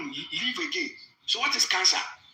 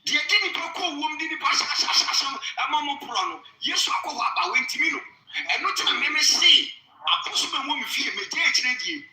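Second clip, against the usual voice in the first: raised, higher Twi preaching voice; fervent preaching; 5 semitones higher than usual. Acted. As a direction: preach it to the congregation with fire. Preaches in Twi at full force.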